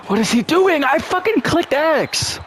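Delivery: very high, strained rage yell, slowing; furious shout. Real, not acted. Gamer raging that his player ignored the button, high shriek. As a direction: shriek it in pure disbelief.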